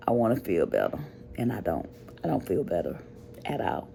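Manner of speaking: quietly sad. Real, not acted. Admits feeling unwell.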